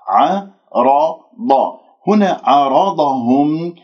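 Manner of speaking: عرضهم with a full heavy ر and ض. Shown as a demonstration. Teacher shows the students the emphatic letters. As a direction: say عرضهم slowly with both letters made heavy. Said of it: "الراء مفخمة".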